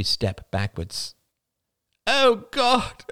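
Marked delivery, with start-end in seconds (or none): 2.1-3.0 high, anguished tearful wail; bursting into tears. A narrator's reading. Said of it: "cried Crystal, bursting into tears".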